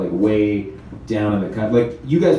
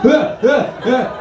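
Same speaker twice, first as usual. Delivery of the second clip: high mock yelps; 14 semitones higher, much slower than usual. Falling Nazis' cries.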